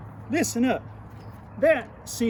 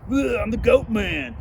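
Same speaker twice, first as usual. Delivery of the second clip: goat man; 3 semitones higher, 5 dB louder than usual. Retold or imitated. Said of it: "He'd be like".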